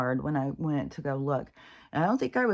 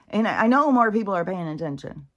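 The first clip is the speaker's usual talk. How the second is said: ordinary talk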